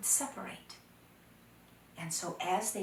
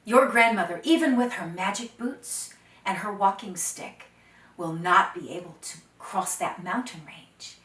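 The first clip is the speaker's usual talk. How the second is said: confident boast; 9 dB louder than usual. Acted. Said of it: "Oh, Dakota laughed and he said".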